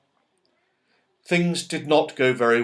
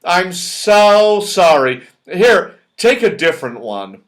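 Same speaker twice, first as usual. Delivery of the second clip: Moonface apologising; 4 semitones higher, 8 dB louder than usual. Acted. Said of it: "said Moonface".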